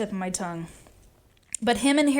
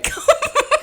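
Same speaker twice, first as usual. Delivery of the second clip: loud high-pitched laugh; high burst of laughter; 4 dB louder than usual. Real, not acted. Laughs at her own joke.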